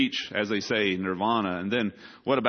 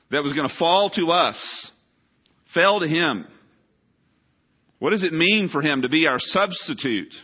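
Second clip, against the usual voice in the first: raised, louder pulpit appeal; preaching at full force; 5 semitones higher, 5 dB louder than usual. Real, not acted. Preacher pleading with the congregation about Christ's pain, forceful. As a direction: press the congregation hard.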